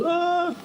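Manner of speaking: high startled shriek, played for laughs; mock shriek. Vlogger imitating his own startled cinema scream. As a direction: yelp as if a monster jumped out.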